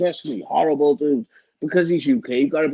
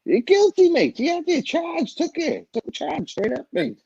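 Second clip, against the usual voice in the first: excited insistence; 11 semitones higher than usual. Real, not acted. Insisting he pled guilty.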